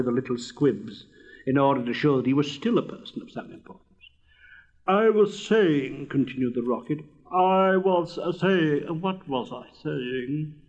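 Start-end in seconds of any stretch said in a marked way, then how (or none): 4.9-10.5 slow, grand start that loses its thread; resume importantly, then falter and wonder; pompous rocket. Acted. Rocket resuming his speech after an interruption, pompous.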